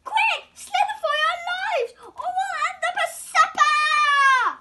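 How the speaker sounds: high, loud frantic yell; panicked shout. Acted. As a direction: scream it as you flee. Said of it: "cried the snails in panic".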